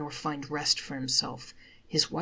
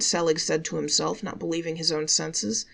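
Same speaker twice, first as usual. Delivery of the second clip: ordinary delivery, nothing marked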